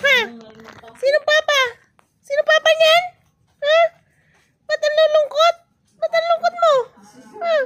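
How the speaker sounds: high, coaxing pet-talk voice; playful questioning. Real, not acted. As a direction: tease a little one with high, insistent questions. Teasing questions to a silent bebe.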